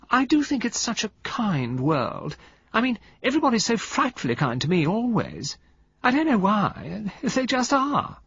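high, sweet society-lady voice; sweet drawl. Acted. Valentine charming the men on the beach, high sweet drawl. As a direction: coo it like a pampered society lady. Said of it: "sweet, drawling voice".

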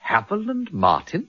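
incredulous echo. Acted. Peter hears shocking name.

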